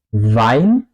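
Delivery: "Wein" with the W voiced like a v; W alemana como V. Shown as a demonstration. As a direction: say "Wein" as "vain". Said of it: "como una V en español". ("Wein" con la W pronunciada como una V)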